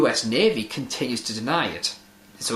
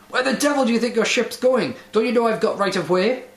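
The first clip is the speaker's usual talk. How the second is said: roaring; 6 semitones higher than usual. A narrator's reading. Ship's officer yelling at the lighthouse keeper about right of way, furious roar. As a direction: yell it across the water in outrage. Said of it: "he roared".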